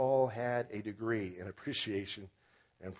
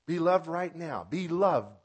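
louder, higher, punching each short command; emphatic exhortation; 5 semitones higher, 7 dB louder than usual. Real, not acted. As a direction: urge it on the congregation with force. Emphatic refrain closing a list.

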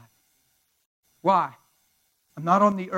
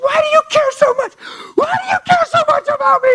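piercingly high screamed imitation; imitated freak-out; 12 dB louder than usual. Woman demands why pastor cares, imitated freak-out. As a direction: scream it wildly, as if hysterical. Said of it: "Freaking out, swinging at the air".

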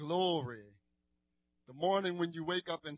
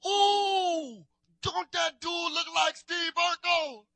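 much higher, louder mocking call; imitating a schoolkid; 12 semitones higher, 6 dB louder, slower than usual. Kid at school mocking his Urkel look, high and loud. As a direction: tease like a New Orleans kid spotting him. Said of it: "this is how they talk".

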